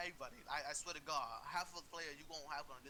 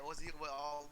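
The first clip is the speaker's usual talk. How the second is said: ordinary delivery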